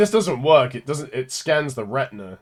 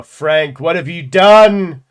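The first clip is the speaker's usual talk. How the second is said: much louder, higher, slow theatrical shouting; hammed-up rage; 8 semitones higher, 10 dB louder, much slower than usual. Player scolds game character Frank with slow theatrical shouting. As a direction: rail at him like a stage villain.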